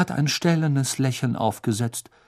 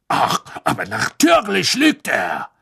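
sneering, louder scorn; scoffing; 8 semitones higher, 5 dB louder than usual. Acted. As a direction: scoff with contempt. Snape scoffing.

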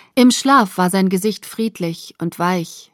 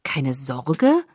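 disbelieving echo, rising sharply at the end; incredulous; 5 semitones lower than usual. A narrator's reading. Bella echoes Jacob's dismissal of the danger, incredulous. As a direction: repeat it as an unbelieving question. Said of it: "sagte ich ungläubig".

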